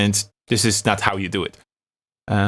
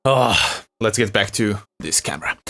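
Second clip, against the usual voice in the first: ordinary talk, nothing marked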